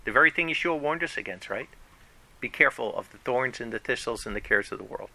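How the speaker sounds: ordinary talk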